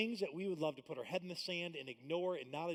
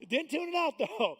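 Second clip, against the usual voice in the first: louder and higher, playful; loud high punchline; 13 semitones higher, 11 dB louder than usual. Real, not acted. Preacher lands the joke to congregation, loud and high. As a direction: sell the joke big.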